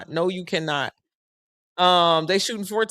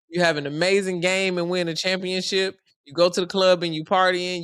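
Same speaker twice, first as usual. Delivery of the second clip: ordinary talk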